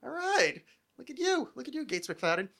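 mock admiring. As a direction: coo it like a sly compliment. Adult self admiring actress.

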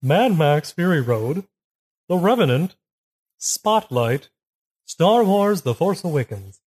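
slow, drawn-out posh announcer. Pretend. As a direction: read the nominees like a posh host. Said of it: "Why are you sounding like a posh?".

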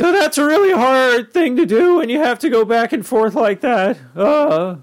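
high, cracking adolescent voice; Squeaky Voice Teen. Shown as a demonstration. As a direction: talk in a put-on squeak far above your own pitch. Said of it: "between his high voice and his low voice".

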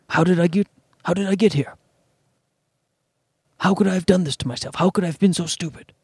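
lightly voiced Sir Anthony Hopkins, low and somber; a hint of Hopkins scolding Bob about shame; Anthony Hopkins impression. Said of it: "it's not a great Anthony Hopkins impression".

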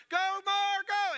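high, loud urging cheer; coach shouting. Acted. Coach waving the runner round first, high loud shout. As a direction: yell her onward like a coach. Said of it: "coach is waving her around".

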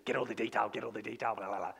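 dismissive mutter. Shown as a demonstration. Dismissive aside on topical study.

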